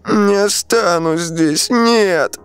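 put-on high, slow crying girl; tearful child. A narrator's reading. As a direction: plead like a little girl in tears. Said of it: "по щекам Кати потекли струйки слёз".

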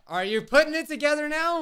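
high, loud excited question; excited outburst. Real, not acted. Player breaks off to viewers, excitedly calling out.

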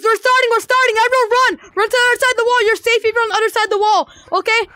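high, loud game call; rally everyone to run; loud announcing. Real, not acted. Announcing game start to players.

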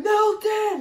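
high mock holler; hammed-up shouted name; imitated yell. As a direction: yell the name as if calling someone over. Acting out the man yelling.

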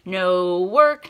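ordinary delivery

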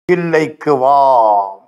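god's parting call. Acted. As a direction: speak as the Lord calling from afar. God calls him, then vanishes.

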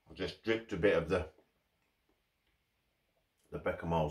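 speaking between bites; chewing while talking. Real, not acted. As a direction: mouth full of food. Seen 2: Holds toasted sandwich at mouth, biting and chewing throughout.

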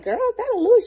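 high, surprised sister voice; lightly voiced surprised older sister; mock sister. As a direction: imitate the sister doubting the bag. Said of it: "she was like".

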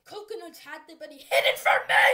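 furious yell at Coconuts; angry shout. Acted. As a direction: scream it in outrage. Furious at hidden disease.

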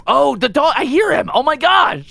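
high, loud fake gasp at the dog; mock amazement. Teasing friend about his fake dog, hammed-up surprise. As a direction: overplay being amazed.

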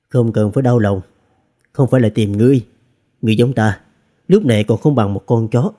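plain reading voice

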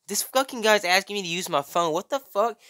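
mildly put-on high, scornful voice; mocking girl. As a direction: sneer to a phone camera about some weirdo. Voicing a girl mocking him on Snapchat.

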